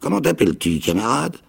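a wild islander, half mad and eager; odd castaway. A narrator's reading. Ben Gunn voice.

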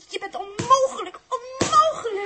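rising to a loud, frustrated cry; exasperated outburst. Acted. Els exasperated with Dirk, loud frustrated cry. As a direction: throw the words at him in despair.

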